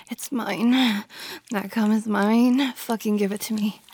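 ordinary talk

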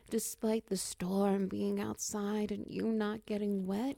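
ordinary delivery

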